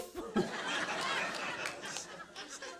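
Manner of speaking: high breathless laugh. Real, not acted. Comedian cracking up at his own airport story, high laugh. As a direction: laugh helplessly at yourself.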